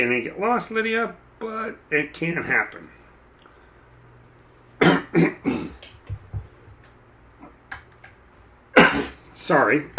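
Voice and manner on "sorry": coughing with a dry throat; dry, scratchy cough. Real, not acted. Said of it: "Dry throat again today".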